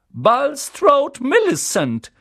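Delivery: high, loud call across the hall; calling out name. A narrator's reading. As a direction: announce the pupil to the whole room. Calls out names. (Professorin ruft laut den Namen auf)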